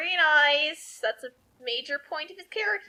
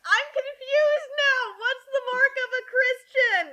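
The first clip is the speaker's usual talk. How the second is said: higher, louder sarcastic mock indignation; mock outrage; 8 semitones higher, 4 dB louder than usual. Hosts mocking the author's logic, higher and louder sarcasm. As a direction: ham up the pious outrage, half crying.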